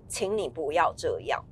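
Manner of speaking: low, quiet, stern request; stern, not loud. Shown as a demonstration. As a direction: say it firmly with the voice dropped. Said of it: "用比较严厉的口气说".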